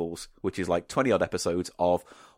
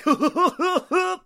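high-pitched zany crew hoots; wacky high whoop-laughter; mock posse whooping; 4 dB louder than usual. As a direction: imitate whooping radio sidekicks. Imitating the crew's whooping.

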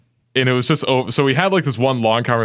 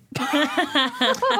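high squealing burst of laughter; shocked laughter; 4 dB quieter than usual. Real, not acted. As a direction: laugh out in disbelief. Reacting to shocking text.

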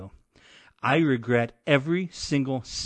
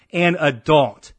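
"an adult" punched louder and slower; all caps emphasis; 3 semitones higher, 6 dB louder than usual. Shown as a demonstration. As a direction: hit "an adult" hard, like shouting capitals. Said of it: "All caps".

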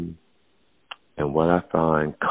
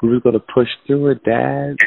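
put-on higher, earnest teenage plea; pleading daughter; 8 semitones higher than usual. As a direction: plead like a heartbroken girl. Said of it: "She said".

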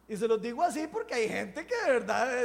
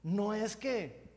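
ordinary talk, nothing marked

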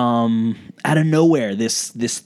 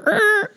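high record scratch sound. Retold or imitated. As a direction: mimic a needle scratch.

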